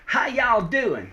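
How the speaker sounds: loud, slow, high Southern drawl; Southern accent. Acted. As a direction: greet them in a broad down-home Southern accent. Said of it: "change my accent a bit".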